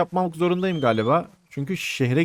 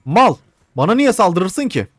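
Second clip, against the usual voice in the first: loud, higher-pitched, stretched-out protest; indignant outburst; 5 semitones higher, 6 dB louder, slower than usual. Real, not acted. Gamer to his attacker in the game, indignant outburst. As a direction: protest loudly at being attacked.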